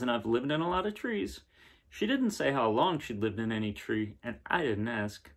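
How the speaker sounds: gentle storybook reading, a bit brighter; reading to children. Acted. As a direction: read the picture book aloud to kids. Reading picture book aloud.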